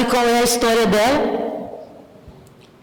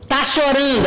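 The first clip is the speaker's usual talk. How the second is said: ordinary talk, nothing marked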